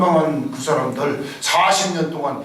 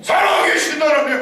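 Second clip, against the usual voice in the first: loud, much higher preacher's cry; fervent preaching; 11 semitones higher, 4 dB louder than usual. Real, not acted. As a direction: proclaim it to the congregation. Preaching at full force. (힘찬 설교 외침)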